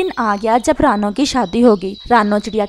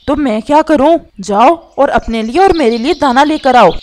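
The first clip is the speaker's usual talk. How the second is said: louder, annoyed husband voice; irritated ordering; 2 semitones higher, 5 dB louder than usual. Acted. Husband ordering his hungry wife out for grain, irritated and loud. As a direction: order them off with raised irritation.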